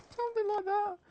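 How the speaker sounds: quick high-pitched voice demo. Shown as a demonstration. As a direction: flip up into a high falsetto for a moment. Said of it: "I seem to be able to do high-pitched voices".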